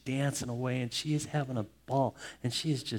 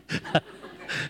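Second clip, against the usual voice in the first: short laugh. Real, not acted. Pastor laughs at his own joke.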